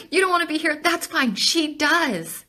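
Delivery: high, loud and worked up; heated, emotional insistence; impassioned challenge. Real, not acted. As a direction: fire it back at the doubters.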